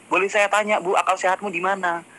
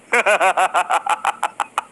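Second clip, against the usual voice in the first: amused laugh. Real, not acted. Laughs at his own jab.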